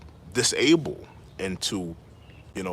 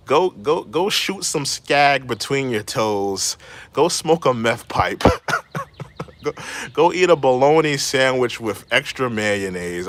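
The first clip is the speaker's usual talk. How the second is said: louder, slower taunting jabs; gleeful taunting; 3 semitones higher, 5 dB louder, slower than usual. Real, not acted. Streamer roasting the complainers, loud and gleeful. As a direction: fire back with mocking glee. Mock insults back at complainers.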